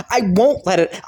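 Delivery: ordinary talk, nothing marked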